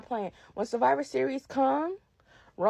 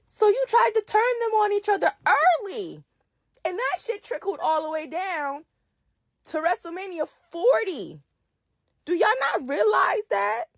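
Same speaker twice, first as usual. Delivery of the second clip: rising angry rant; 8 semitones higher than usual. Real, not acted. Ranting, voice climbing to a shout.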